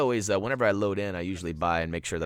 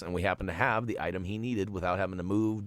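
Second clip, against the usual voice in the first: ordinary delivery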